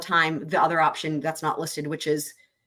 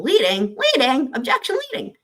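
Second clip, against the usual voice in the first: rising, louder cries of leading, objection leading jumping very high; mock courtroom objection; 7 semitones higher, 5 dB louder than usual. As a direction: object like an opposing lawyer, half in jest. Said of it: "you might be thinking".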